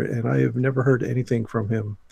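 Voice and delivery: ordinary talk, nothing marked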